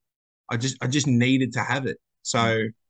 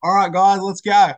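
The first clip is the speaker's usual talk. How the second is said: pumped-up coach call; 6 semitones higher, 7 dB louder than usual. Retold or imitated. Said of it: "I'm like".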